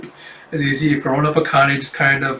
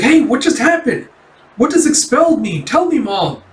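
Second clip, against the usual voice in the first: high-pitched, urgent questioning; anxious plea, voice pushed way up; distressed child; 10 semitones higher, 4 dB louder than usual. Retold or imitated. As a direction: imitate a confused kid demanding answers. Child confused by father's expulsion.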